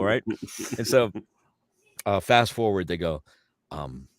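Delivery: usual speaking voice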